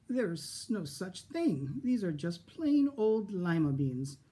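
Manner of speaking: slow, gentle grandmotherly voice; a sweet old lady, patient; kindly old woman. Acted. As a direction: set her straight kindly. Kind old woman explains.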